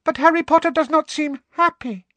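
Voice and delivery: very high, timid squeaky voice; timid squeaky house elf. A narrator's reading. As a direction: ask shyly like a worried little elf. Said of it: "looking timidly at Harry".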